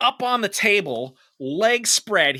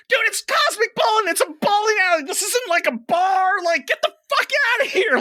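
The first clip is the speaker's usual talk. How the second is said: high-pitched, worked-up rant; hammed-up exasperated argument; retold outburst. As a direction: go off on him, voice pitched way up. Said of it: "I just went off on this guy".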